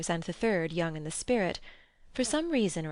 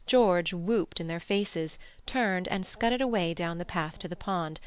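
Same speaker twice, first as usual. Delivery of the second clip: ordinary delivery, nothing marked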